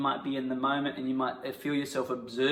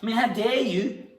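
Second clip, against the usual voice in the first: high, loud mock outrage; punchy indignation; 7 semitones higher, 4 dB louder than usual. Acting teacher punching lines at partner in mock anger. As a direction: hit each accusation hard. Improvised quarrel, punch exercise.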